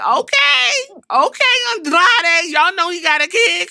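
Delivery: high, squealed hype; hyped approval. Retold or imitated. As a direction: cheer him on in a playful high voice. Acts out own hyped approval.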